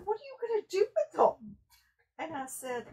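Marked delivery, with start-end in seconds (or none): 0.0-1.6 fast, much higher mock-bewildered voice; baffled question. Cashier baffled by her plan for the shirts, high fast disbelief. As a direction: imitate the cashier's stunned disbelief. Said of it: "she about had a fit".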